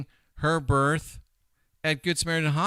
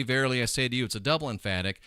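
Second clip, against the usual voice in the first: ordinary talk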